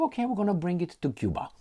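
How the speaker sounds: high, quick mock Khrushchev line; Khrushchev quote. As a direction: rattle it off in a high, hurried mock voice. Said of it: "And Khrushchev".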